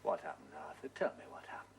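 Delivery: soft, breathy whisper drawing him in; a quiet, intimate push for the truth; hushed coaxing. Acted. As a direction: lean close and whisper the question. Coaxing a suspect to confess.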